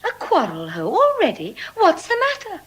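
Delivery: loud and rising in surprise; loud surprised outcry; exclaiming. Acted. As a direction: break up the quarrel with a cry. Portia interrupts a quarrel.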